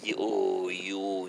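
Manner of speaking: ordinary talk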